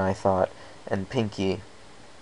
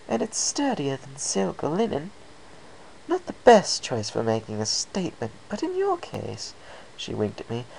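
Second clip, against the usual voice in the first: refined, higher lady voice; a hint of an elegant fashionista; lightly voiced Rarity; 8 semitones higher than usual. Rarity appraising.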